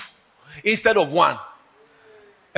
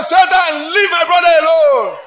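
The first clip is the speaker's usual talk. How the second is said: higher, loud command to Satan; loud rebuke; 7 semitones higher, 7 dB louder than usual. Shown as a demonstration. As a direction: shout Satan away from a brother. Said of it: "we are getting skill in the spirit".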